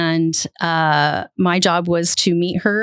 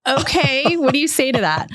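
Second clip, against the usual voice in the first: high-pitched, dumbfounded aside; acted disbelief at an absurd demand; incredulous reaction; 9 semitones higher than usual. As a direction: throw up your hands, voice jumping high. Teller's stunned reaction to the order.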